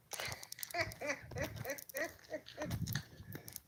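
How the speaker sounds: soft, high, airy giggle; breathy little laugh, almost silent; kind gentle laugh. Real, not acted. Laughing at a chat joke.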